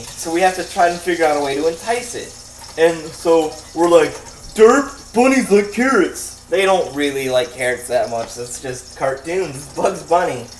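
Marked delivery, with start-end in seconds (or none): 4.5-6.4 high, loud dopey derp voice; dumb voice. Retold or imitated. Mocking their own clueless carrot idea, high and dopey. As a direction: play it like a clueless goof.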